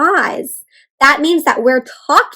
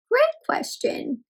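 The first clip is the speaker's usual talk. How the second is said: delighted praise; 14 semitones higher, 7 dB quieter, slower than usual. Real, not acted. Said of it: "praise after child asks question".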